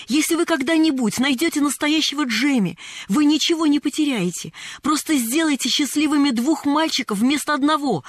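put-on high, eager child's outpouring; agitated. A narrator's reading. Pollyanna persuades Mrs. Carew to help, excited. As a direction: urge breathlessly on, building in excitement. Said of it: "взволнованно подхватила Полианна".

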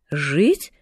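low questioning echo, rising sharply at the end; puzzled aunt's one-word question; surprised. A narrator's reading. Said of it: "удивлённо подняла брови тётя Поля". (удивлённый переспрос)

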